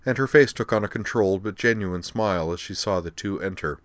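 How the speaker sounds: plain reading voice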